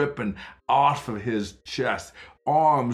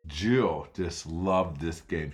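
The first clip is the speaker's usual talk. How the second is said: plain reading voice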